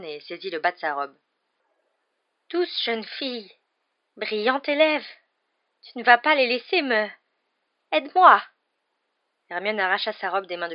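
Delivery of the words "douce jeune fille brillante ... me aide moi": high-pitched pleading, growing louder; desperate plea. Acted. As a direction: beg on your knees for help. Grovelling plea for help. (supplication désespérée)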